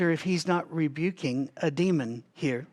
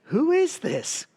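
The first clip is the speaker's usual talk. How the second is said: higher, louder, slow astonished question; awed alarm; 11 semitones higher, 4 dB louder, slower than usual. Acted. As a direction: ask it in startled, terrified awe. Frightened disciples' awed question, read aloud.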